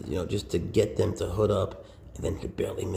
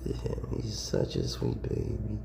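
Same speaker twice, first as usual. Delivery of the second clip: ordinary delivery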